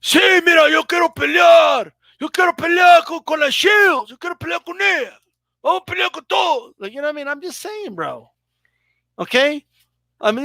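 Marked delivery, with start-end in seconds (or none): 0.0-6.7 high, loud Spanish shouting boast; mock the boxer calling everyone out; imitating Jimenez. Mocking Jimenez's post-fight interview, loud high shout. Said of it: "the interview after her fight".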